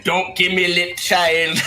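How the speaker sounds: imitated Jamaican scolding; mock-stern Jamaican teacher. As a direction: tell a pupil off in a Jamaican accent. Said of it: "he was proper Jamaican".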